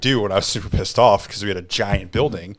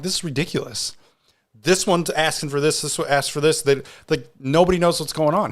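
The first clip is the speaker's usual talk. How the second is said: higher-pitched venting to a coworker; retold exasperation; 7 semitones higher than usual. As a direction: re-enact being fed up, pitched up. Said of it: "Why are you so mad?".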